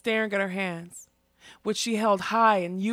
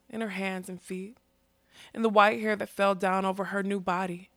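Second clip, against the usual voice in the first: usual speaking voice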